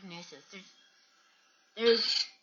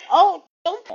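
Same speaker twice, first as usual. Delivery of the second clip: higher and louder, a quick scolding call; sharp warning; 11 semitones higher, 12 dB louder than usual. Real, not acted. Scolding the pet as it reaches for something, sharp loud call. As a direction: stop the pet mid-mischief.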